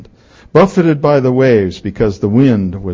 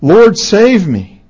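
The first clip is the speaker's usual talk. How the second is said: raised, drawn-out cry for rescue; 7 semitones higher, 5 dB louder, slower than usual. Acted. Sinking Peter to Jesus, crying out. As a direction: cry out slowly, higher and louder. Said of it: "he cried out".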